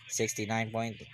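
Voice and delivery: usual speaking voice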